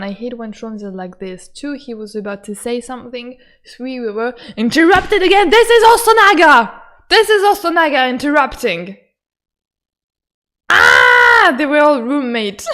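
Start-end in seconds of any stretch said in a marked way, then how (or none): 4.5-9.0 loud, high-pitched outburst; exasperated shouting. Real, not acted. Gamer complains they were interrupted again, exasperated shout. Ranting about being interrupted. 10.7-11.5 loud, high scream; excited scream. Real, not acted. Gamer finds out they were roommates, excited scream.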